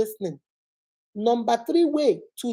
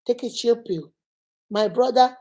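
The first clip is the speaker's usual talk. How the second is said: ordinary talk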